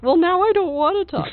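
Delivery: loud, high mocking repeat; mocking echo. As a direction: parrot it back, teasing. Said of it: "echoing S0's line".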